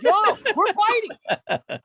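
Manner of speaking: high, loud, drawn-out yell of a challenge; reliving the street challenge in a big, slow holler; retold yell. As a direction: shout it slowly after him up the block. Retold chasing the FedEx guy.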